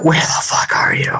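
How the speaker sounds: hushed whisper. Real, not acted. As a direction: whisper it, searching. Searching for hidden pet.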